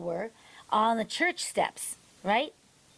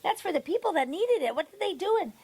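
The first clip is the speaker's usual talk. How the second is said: high-pitched, indignant retold questions; a hammed-up shocked crowd voice; imitating outraged onlookers; 8 semitones higher than usual. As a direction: mimic bystanders shouting in disbelief. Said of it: "these people are filming this going".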